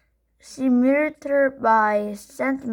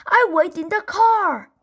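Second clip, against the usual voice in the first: high, loud protest; Henry shouting at mom before the stop; defiant shout; 8 semitones higher, 4 dB louder than usual. A narrator's reading. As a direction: refuse and stand firm.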